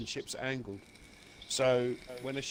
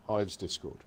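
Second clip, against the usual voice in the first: ordinary talk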